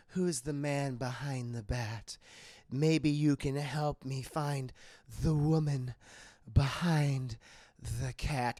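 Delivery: low, slow seductive voice; low slow mock-seductive Catwoman; sultry Catwoman. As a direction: purr it slowly with long pauses. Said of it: "she starts saying".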